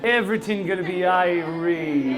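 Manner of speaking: slow Caribbean accent, words stretched out; laid-back Caribbean lilt, taking it slowly. Shown as a demonstration. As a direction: do your best Caribbean accent. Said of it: "in your best Caribbean accent".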